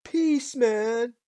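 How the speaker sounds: high, loud stoner hippie; hammed-up peace-man hippie; mock hippie. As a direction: drawl it like a dirty hippie. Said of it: "Like a dirty hippie".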